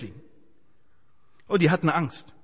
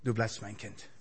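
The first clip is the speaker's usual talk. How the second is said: tender God; 11 semitones lower, 10 dB quieter than usual. Acted. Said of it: "Und trotzdem sagt er".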